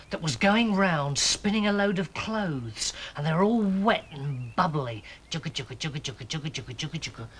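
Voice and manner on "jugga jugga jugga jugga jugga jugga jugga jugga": low, soft rhythmic chug; soft low chugging noise; washing machine chugging. A narrator's reading. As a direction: imitate a machine churning quietly and evenly. Narrator imitates washing machine noise.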